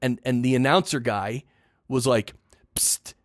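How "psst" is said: a sharp, hissed psst; imitated psst. Retelling the announcer's interruption, a sharp psst. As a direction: mimic the announcer's sudden psst. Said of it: "He did the psst thing".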